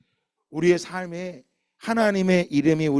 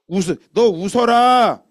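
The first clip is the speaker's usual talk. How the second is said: God commanding; 3 semitones higher, 7 dB louder, slower than usual. Acted. God telling Abraham to laugh.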